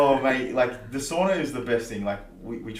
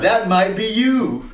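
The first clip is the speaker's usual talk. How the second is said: teasing; 9 semitones higher, 8 dB louder, much slower than usual. Acted. Teasing school kids at gym.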